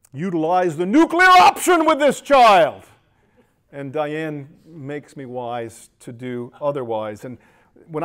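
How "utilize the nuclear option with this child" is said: much louder and higher, a mock blowup; mock explosive. Father joking about losing his temper, mock loud outburst. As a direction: blow up at the idea, tongue in cheek.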